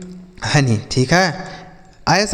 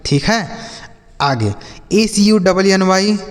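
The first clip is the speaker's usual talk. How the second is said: ordinary talk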